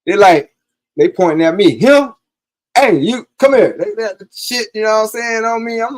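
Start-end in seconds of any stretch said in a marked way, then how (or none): 1.8-3.8 loud, high shout, calling someone over; police shouting across the yard at him; cops calling out. Retold or imitated.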